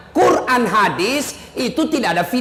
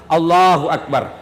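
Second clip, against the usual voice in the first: takbir demo; 8 semitones lower than usual. Shown as a demonstration. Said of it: "ulama pertama begini".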